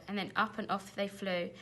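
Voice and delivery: ordinary delivery, nothing marked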